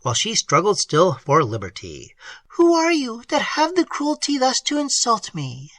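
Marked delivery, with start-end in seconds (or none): 2.5-5.7 high, frightened reproach; terrified and indignant. A narrator's reading. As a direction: demand it high, afraid and offended. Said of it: "in a tone of mingled terror and indignation".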